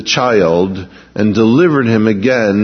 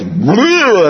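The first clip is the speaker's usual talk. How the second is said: mock retching noise; 8 semitones higher, 3 dB louder than usual. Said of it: "and Hannah goes".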